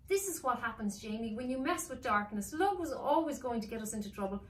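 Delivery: a sister scolding, rising to a shout; scolding girl. Acted.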